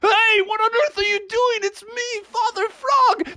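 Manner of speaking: very high, indignant squawk; protesting dad. Acted. Father Frog gets blasted by family, protesting. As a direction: protest high, stretching the last words. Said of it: "He's like".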